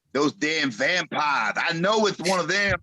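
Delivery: high, slow start to an accusing wrestler impression; mock-accusing wrestler voice; Dream. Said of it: "he says something like".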